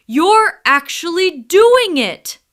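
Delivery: loud, high, thrilled cry; lion amazed the mouse is freeing him, excited; amazed excitement. Acted. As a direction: burst out in amazement.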